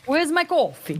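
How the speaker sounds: put-on a halting try at a New York accent; attempted New York accent. Guest tries a New Yorker accent when asked by chat. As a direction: fumble through a New York accent on request. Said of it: "say it like a New Yorker".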